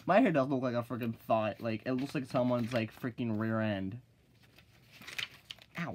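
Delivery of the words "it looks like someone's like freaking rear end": ordinary talk, nothing marked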